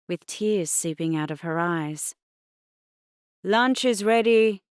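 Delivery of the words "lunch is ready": called out loud and high up the stairs; calling out. Acted. Dad calling upstairs to the kids, loud. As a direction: call the family down for lunch. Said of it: "Dad called out".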